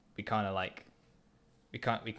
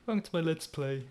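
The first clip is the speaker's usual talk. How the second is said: higher, cheesy channel-intro voice; mock YouTuber intro; 6 semitones higher than usual. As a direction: play a corny gamer host.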